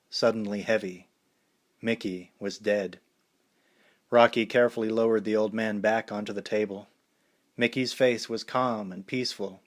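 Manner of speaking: plain reading voice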